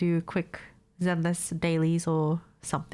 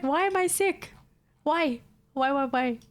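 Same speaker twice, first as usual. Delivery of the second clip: higher, whining why; whiny complaint; 8 semitones higher than usual. Retold or imitated. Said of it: "I'm so annoyed".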